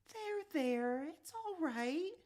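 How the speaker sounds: high, slow soothing pat-on-the-head voice; calm him down gently; soothing mom. Acted. Mom comforts crying John.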